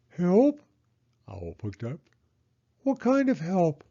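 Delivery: high, curious owl; Owl's voice. Acted. Owl asking Mole what help they need, high and curious. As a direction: ask it like a nosy owl.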